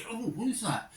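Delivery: curious surprise. Retold or imitated. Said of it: "and she".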